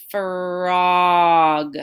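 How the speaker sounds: slow and smooth. Shown as a demonstration. Teacher builds "Frog" sound by sound for students. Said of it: "say the word slow and smooth".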